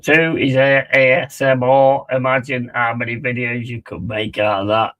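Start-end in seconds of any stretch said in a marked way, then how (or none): none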